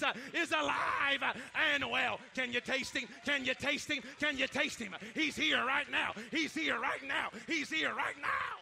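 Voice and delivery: loud, shouting preacher building the crowd up; preaching at full force. Acted. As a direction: preach it hard, calling out to the church.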